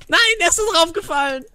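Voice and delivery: high-pitched cry of dismay; dismayed outcry. Real, not acted. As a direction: cry out as the game goes wrong. Mishap in the game.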